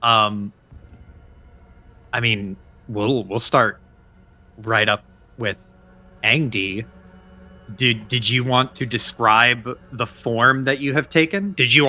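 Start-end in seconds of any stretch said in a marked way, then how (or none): 0.0-6.8 measured scene-setting read; game master narration. Acted. Game master sets the dusk scene, narrating calmly. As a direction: set the night scene for players.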